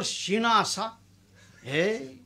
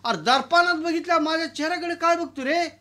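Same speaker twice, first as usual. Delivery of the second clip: higher, louder bossy scolding; loud scolding landlord; 7 semitones higher, 4 dB louder than usual. Acted. Landlord ordering peon to lift sacks, loud scold.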